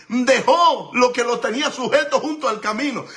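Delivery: normal talking voice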